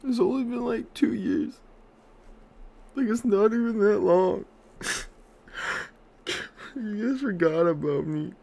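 high squeaky whine of mock offense; mock hurt squeak.

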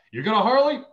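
high, loud surprised question; surprised client. Acted. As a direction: burst in with eager surprise. Acts out surprised client on call.

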